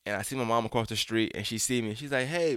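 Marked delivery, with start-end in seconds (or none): 2.3-2.6 higher, excited mom's hello; excited greeting. Retold or imitated. Mom spotting her son across the street, higher, excited. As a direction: call it out brightly, as a pleased mom. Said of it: "My mom's mad excited".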